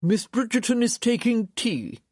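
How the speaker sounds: high, formal butler announcement. Acted.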